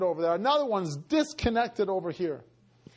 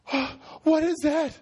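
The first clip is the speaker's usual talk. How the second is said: high, alarmed cry, rising into a shout; acted-out frightened exclamation; freaking out; 11 semitones higher than usual. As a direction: panic at a startling sight. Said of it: "She starts freaking out at the wall".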